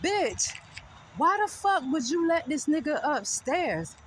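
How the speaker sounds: retold high-pitched reproach; indignant scolding. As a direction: take the twin to task, acted out. Acted scolding of twin.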